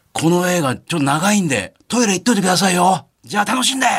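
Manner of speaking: retold stage call to the audience, voice lifted high; retold unmiked call-out. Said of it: "地声でわーって言う".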